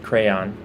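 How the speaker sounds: "crayon" as "CRAY-on"; own pronunciation. Shown as a demonstration. Telling viewers her way, "crayon" as "cray-on". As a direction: say "crayon" in two clear syllables. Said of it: "two syllables".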